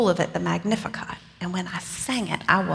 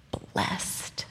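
soft whispered Blessed, breath only; 8 dB quieter than usual. Acted. Mary softly echoing Elizabeth's blessing, whispered. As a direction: breathe the word Blessed like a secret.

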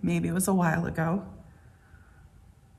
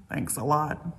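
snarky, lower and softer thanks; sarcastic; 4 semitones lower, 5 dB quieter than usual. Pretend. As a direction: drip sarcasm, low and soft. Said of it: "that layer of snark or sarcasm".

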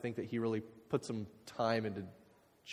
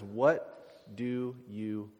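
slow, weighty question; much slower than usual. Acted.

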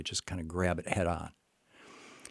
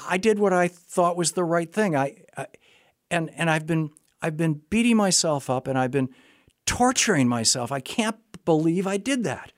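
relieved outburst; 10 semitones higher, 7 dB louder than usual. Retold or imitated. Veteran telling the therapist it wasn't his fault, high and loud. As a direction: burst out with years of relief.

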